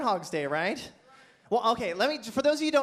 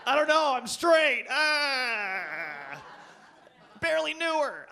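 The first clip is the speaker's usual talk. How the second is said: mock straight bro; 5 semitones higher, slower than usual. Comic testing his straight voice on the crowd, hammed-up bro. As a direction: ham up a dumb straight bro. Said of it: "my straight voice".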